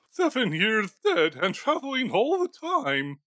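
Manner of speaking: put-on a nervous old skinflint questioning a ghost; Scrooge voice. Scrooge muses aloud.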